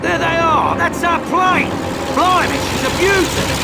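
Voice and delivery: high, loud joyful calls; excited shout. Acted. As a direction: cheer at the sight of the plane. Spotting the escape plane.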